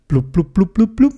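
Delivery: quick popping "blub" noises; imitated bubbles. A narrator's reading. Theobald agreeing to help underwater, quick bubbling noises. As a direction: pop out a rising string of bubbles.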